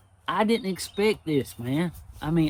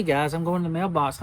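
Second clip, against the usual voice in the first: ordinary talk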